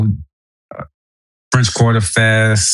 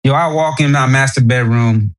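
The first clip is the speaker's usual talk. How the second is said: ordinary delivery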